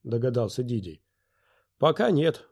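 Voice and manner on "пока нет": ordinary delivery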